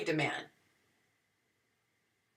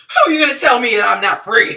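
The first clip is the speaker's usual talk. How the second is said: indignant laughing retort; 4 semitones higher, 13 dB louder, much faster than usual. Retold or imitated. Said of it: "I said".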